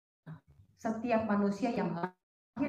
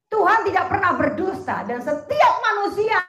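preaching; 7 semitones higher, 12 dB louder than usual. Acted. Preaching at full force.

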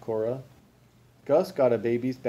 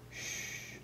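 soft, voiceless shush; hushing; 16 dB quieter than usual. A narrator's reading. Gus hushes furious Frizzle, soft shush. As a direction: hush him gently.

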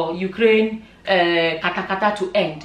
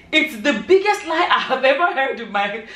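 real laugh breaking up the sentence; laughing while reading; 3 semitones higher than usual. Real, not acted. Vlogger reading a comment to viewers, losing it to laughter. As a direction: try to read it straight and crack up before finishing. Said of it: "I really want to read this thing without laughing".

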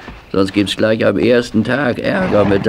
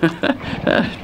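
soft chuckle; 5 semitones higher, 6 dB quieter than usual. Acted. Old groom amused.

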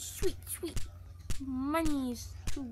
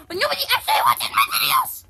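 high, loud laughing yell; laughing shout; 14 semitones higher, 15 dB louder, faster than usual. Real, not acted. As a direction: blurt it out through a laugh. Joking complaint about no viewers.

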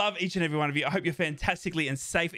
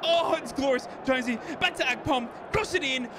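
much higher, laughing and crying, shouting names; ecstatic crying shouts; 9 semitones higher, slower than usual. Real, not acted. Fan screaming with joy at the late winner. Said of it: "I'm crying".

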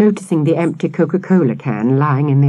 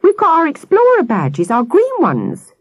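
proud; 14 semitones higher, 4 dB louder than usual. Acted. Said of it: "he added proudly".

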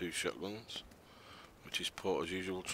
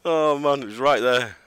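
excited shout; 6 semitones higher, 12 dB louder, slower than usual. Real, not acted. Enemy right next to him.

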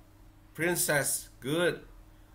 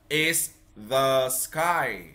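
is the sky said very slowly, word by word; slow model phrase; 2 semitones lower, much slower than usual. Shown as a demonstration. Teacher models the right phrase for the child, very slowly. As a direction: stretch every word so the child can copy. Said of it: "con dùng là".

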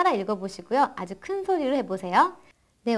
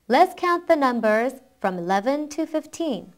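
faster model reading in one go; quicker read-through; much slower than usual. Shown as a demonstration. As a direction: run the phrases together without pausing. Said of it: "한번 빨리 읽어볼게요". (끊지 않고 조금 빨리 읽기)

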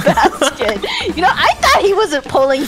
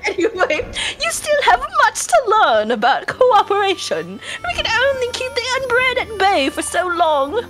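hammed-up British dialogue reading; terrible British accent; 5 semitones higher than usual. Player reads the game's advice on cooperation, mock British accent. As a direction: read it grandly in a fake British accent. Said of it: "My terrible British accent".

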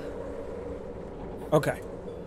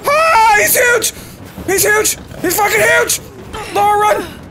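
panicked yelling; 12 semitones higher than usual. Real, not acted. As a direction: shout in panic to your partner. Cougar still attacking.

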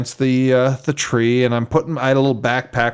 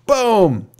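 high, drawn-out boom, falling; gunshot imitation; 8 semitones higher than usual. Hunter retelling his second shot at the can, mimicked bang. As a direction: mimic the rifle going off.

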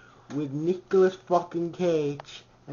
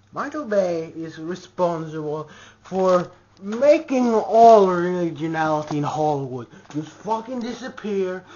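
usual speaking voice

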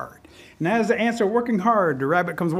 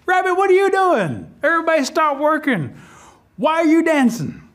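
indignant queen ant; 9 semitones higher, 3 dB louder than usual. A narrator's reading. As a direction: demand answers in a high, raised voice. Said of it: "The queen ant comes out and says".

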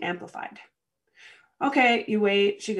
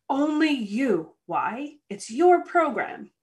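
normal talking voice

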